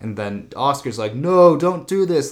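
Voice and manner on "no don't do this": mock panicked plea. Oscar pleads in retelling.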